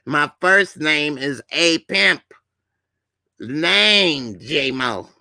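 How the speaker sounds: slow, insistent self-introduction; put-on pimp character. Correcting someone who shortened his name, slow and emphatic. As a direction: stretch each word of the name, grandly.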